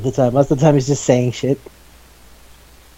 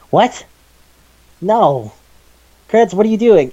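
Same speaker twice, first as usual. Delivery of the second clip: a raised, startled what are you doing; imitating disbelief, pitched up; mock shocked; 6 semitones higher than usual. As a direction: react like you can't believe what he just did. Retold shock at Kurtz.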